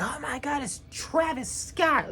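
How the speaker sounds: mocking fans gasping over the rapper's entrance; mock starstruck fans. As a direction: squeal it like a starstruck fan, mocking them. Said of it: "They're like".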